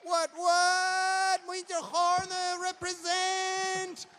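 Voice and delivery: high, loud, slow drawn-out call; rally the crowd for the winner's home place; hype call. Real, not acted. MC calling on the winner's people to cheer, excited call.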